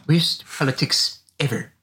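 deadpan nerd verdict, slowed down; slow, drawn-out nerd pronouncement; Comic Book Guy voice. Pretend. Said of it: "You're actually pretty good at that".

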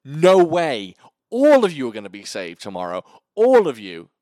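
loud, hammed-up hero vow; heroic declaration. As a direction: boom it out like a movie hero promising rescue. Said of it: "steps forward and goes".

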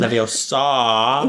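slow LEVIOSA with a rising end; mimicked spell word. Listener echoing the charms lesson, drawn-out rising mimicry. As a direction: stretch LEVIOSA and lift the ending. Mimicking the film's famous line.

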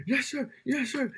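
high-pitched acted recruit reply; imitated panicky boot camp response; obedient recruit. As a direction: answer the instructor in a strained, high voice. Acting recruit answering yelling instructors.

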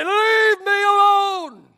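slow, loud, high cry; sulking prophet yelling to be left alone; shouted demand. Retold or imitated. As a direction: drag out a desperate yell. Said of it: "saints like that".

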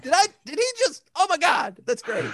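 high, amazed disbelief; surprised. Retold or imitated. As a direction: sound stunned and delighted. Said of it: "I was like".

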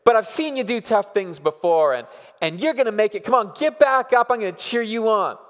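put-on rally a child to get back up; cheering on. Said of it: "And then she'll say".

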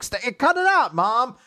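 high, loud whine at a parent; hammed-up exasperated daughter; annoyed protest. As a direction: complain loudly, pitch shooting up. Retold daughter protests at mom.

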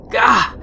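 loud, high-pitched "gah" of frustration; frustrated cry. Acted. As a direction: blurt out exasperation at the duds. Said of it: "Frustration mounted".

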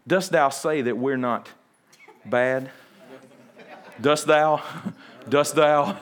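lightly voiced archaic Israelite daring anyone; mock King James. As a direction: play it like a pompous old Bible reading, breaking into a laugh. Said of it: "There'd be some King James".

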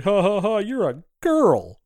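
slow, mocking put-down; mock jeering. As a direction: jeer at her like a bully. Mocking jeers retold.

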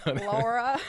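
Laura said LAW-ruh; LAW-ruh with a rounded aw. Shown as a demonstration. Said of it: "Laura with a U".

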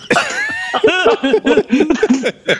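a long, high squealing laugh; high burst of laughter. Real, not acted. Cracking up at the eight-is-hot joke, high squealing laugh.

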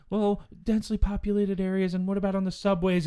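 lightly voiced worried mother; concerned mom. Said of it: "she sounded like a concerned mom".

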